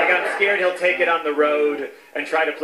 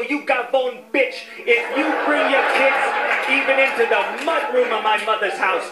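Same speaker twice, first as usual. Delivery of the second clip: hammed-up angry governor, pitched higher; mock angry threat; 5 semitones higher than usual. Governor threatens his brother's wife, mock fury. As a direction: play a New York governor blowing up at family. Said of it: "I go".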